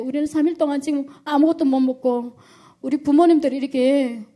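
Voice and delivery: urgent plea to God, voice lifted throughout; desperate prayer. Retold or imitated. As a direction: relive crying out to God for help. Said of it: "하나님 앞에 또 다시 기도하기 시작했어요".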